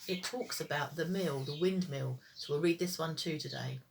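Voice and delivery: ordinary delivery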